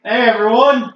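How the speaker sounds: high, loud, sheepish hello; naked prisoner calling up to friends, nervous and loud; nervous greeting. Acted. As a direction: greet them while covering up in embarrassment. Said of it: "you can see him just nervously".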